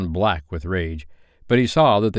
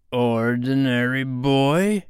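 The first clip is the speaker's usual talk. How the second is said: very slow, higher question; drawn-out, suspicious pirate query; probing captain; 5 semitones higher, much slower than usual. A narrator's reading. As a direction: stretch each word of the guess. Hook guesses slowly.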